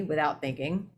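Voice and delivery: ordinary delivery, nothing marked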